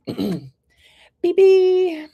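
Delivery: high, loud, drawn-out name call; playful high call to the next person. Real, not acted. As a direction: sing out the name, holding the end.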